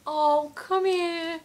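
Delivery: imitating a star beckoning a fan in. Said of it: "he was like".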